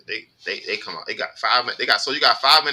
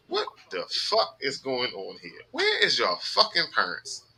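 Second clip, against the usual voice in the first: retold disbelief; 8 semitones higher, slower than usual. Retelling shocked reaction to the kid.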